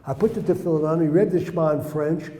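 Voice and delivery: normal talking voice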